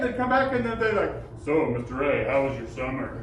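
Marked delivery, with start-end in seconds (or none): 1.4-3.1 mock deep teen voice. Same student, voice now deep.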